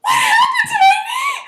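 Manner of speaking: put-on very high, piercing child's shriek; acted scream. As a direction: shriek in full-out horror. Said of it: "And I scream".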